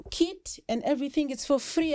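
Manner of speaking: ordinary talk, nothing marked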